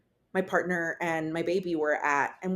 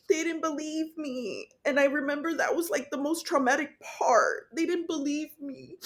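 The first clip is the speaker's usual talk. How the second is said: tearful; 6 semitones higher than usual. Real, not acted. Mother recalling not being believed, high and tearful. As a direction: hold back tears.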